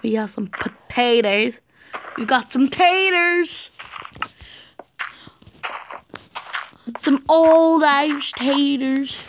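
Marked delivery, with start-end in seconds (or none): 2.2-9.2 higher, louder hammed-up Irish accent. Gamer jokes about Irish taters in a mock Irish accent. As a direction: ham up a stage Irish accent over the taters. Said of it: "I don't have a good Irish accent".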